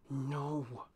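a tight no through clenched teeth; through gritted teeth. A narrator's reading. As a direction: grit your teeth on it. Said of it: "she said through gritted teeth".

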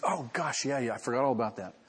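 a hint of breezy, offhand sarcasm; mock casual Jesus. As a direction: play the absurd, easygoing version. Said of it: "And Jesus says".

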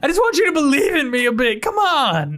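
high, whining plea for belief; worked-up, high-pitched begging; exasperated pleading. Acted. Mole god pleading to be believed.

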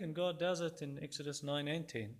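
ordinary talk, nothing marked

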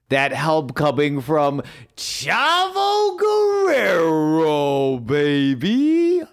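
slow, drawn-out ring announcer call; hype announcer. Acted. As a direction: stretch the name out like a big entrance. Hype intro of returning wrestler.

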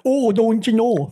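slow, high, drawn-out Minnesotan mockery; mocking Minnesotan accent. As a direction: ham up the Minnesotan accent to tease it. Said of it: "everyone makes fun of it".

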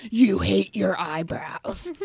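slow, cutting put-down; meanest voice. Retold or imitated. Mocking herself to her friend in a mean, slow sneer. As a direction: sneer it out slowly like the cruelest critic. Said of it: "say it in the meanest, most cunty".

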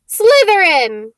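high, loud, slow scream of the house name; screaming hat. Acted. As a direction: scream it out for the whole hall. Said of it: "it screamed".

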